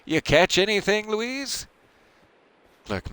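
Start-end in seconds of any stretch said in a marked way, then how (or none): 0.0-1.7 yelling. Acted. Clerk Boehm yelling over to Louise, high call. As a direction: call across the beach to her. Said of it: "yelling to Louise".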